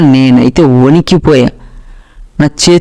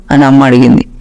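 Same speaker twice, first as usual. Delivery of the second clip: ordinary delivery, nothing marked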